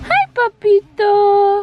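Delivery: slow, high and loud hello; high cooing greeting. Real, not acted. Cooing to baby.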